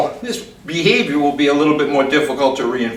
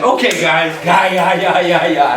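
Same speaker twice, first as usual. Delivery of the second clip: releasing the dogs; 3 semitones higher, 3 dB louder than usual. Shown as a demonstration. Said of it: "I just get low and say".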